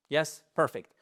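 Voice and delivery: ordinary talk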